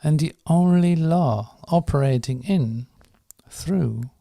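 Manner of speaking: slow, measured prayer with long pauses; slow prayer reading. Real, not acted. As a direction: read each phrase firmly and deliberately, letting it settle. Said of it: "I'll read the first three lines one more time".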